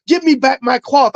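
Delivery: high-pitched imitation of an angry demand; mad, retold. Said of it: "the guy was so mad".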